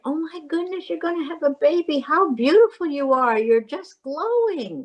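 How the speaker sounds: high-pitched gushing compliments; admiring encouragement. Acted. Midwife greeting the expectant mother, admiring and delighted. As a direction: gush admiringly, drawing out the last praise. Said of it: "other things you can say".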